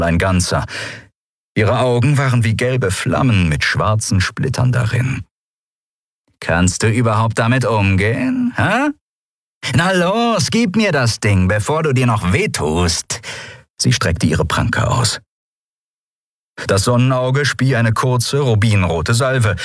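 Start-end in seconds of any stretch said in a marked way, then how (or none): 6.4-13.2 scornful, pitch jumping up impatiently; taunting pirate. Acted. Pirate taunting the boy to hand over weapon, scornful. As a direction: goad him, then demand impatiently.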